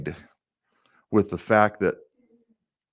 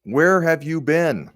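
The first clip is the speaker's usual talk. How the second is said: pointed question; 7 semitones higher, 4 dB louder, slower than usual. Acted. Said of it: "what would I ask the governor".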